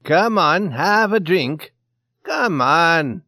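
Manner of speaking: insistent Swede. A narrator's reading. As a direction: press a drink on him loudly. Swede presses keeper to drink.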